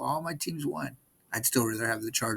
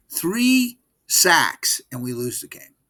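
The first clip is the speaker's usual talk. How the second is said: exasperated; 4 semitones higher, 8 dB louder, slower than usual. Real, not acted. Exasperated fan complaint.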